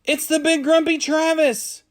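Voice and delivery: put-on high, loud children's yell; excited yell. A narrator's reading. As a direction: shout it out like an excited kid. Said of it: "one of the children spotted him and yelled".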